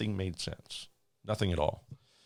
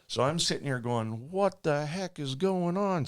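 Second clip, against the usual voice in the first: slow, high bewildered question; 9 semitones higher than usual. Retold or imitated. Attendant wondering about the pilot's silence, slow and bewildered. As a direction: stretch it out, utterly baffled. Baffled on the flight.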